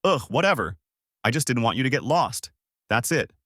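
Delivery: usual speaking voice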